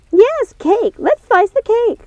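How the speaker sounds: high, slow gleeful cake joy; thrilled about the cake; excited. Acted. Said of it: "clearly very excited about the cake".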